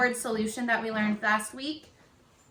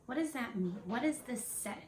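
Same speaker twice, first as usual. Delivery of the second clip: barely voiced, much softer; whispering; 11 dB quieter, faster than usual. Shown as a demonstration. Teacher models whispering the question for students. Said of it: "whisper it out loud to yourself".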